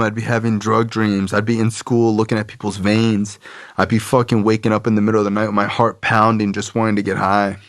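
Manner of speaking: ordinary delivery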